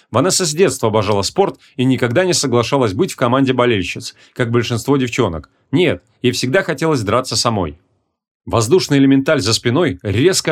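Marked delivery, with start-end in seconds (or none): none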